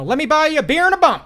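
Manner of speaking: high, loud, quick holler. Retold or imitated. Host quoting dad treating friends, high loud holler. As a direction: burst out with a hearty prospector-style offer. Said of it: "J T says".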